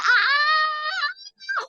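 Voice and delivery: high mock fan scream; crowd scream. Imitating the crowd screaming at the boys. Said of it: "these people are".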